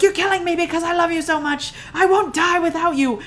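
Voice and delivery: loud, high, hammed-up desperate rush; mock-yell a melodramatic love plea; yelling stalker. Stalker to the woman at the cliff edge, mock yelling. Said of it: "he's, like, yelling things".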